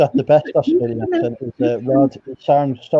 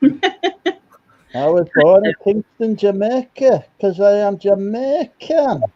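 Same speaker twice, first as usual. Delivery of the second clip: higher, slow, laughing mock Jamaican accent; 5 semitones higher, slower than usual. Friend plays a Jamaican bloke for a laugh, a hammed-up Jamaican accent. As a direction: ham up a Jamaican accent with a chuckle. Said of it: "The Jamaican bloke".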